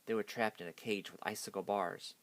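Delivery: ordinary delivery, nothing marked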